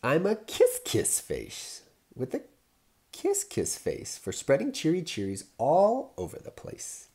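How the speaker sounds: high and loud, gleeful sing-song; cheery kiss refrain. Acted. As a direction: beam the happy refrain out loud. New happy refrain.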